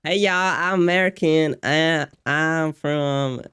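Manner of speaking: a lightly put-on American drawl, slowing at the end; hammed-up Yankee tourist voice; American accent. Answering the podcast question in a mild American accent. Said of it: "give us your American accent".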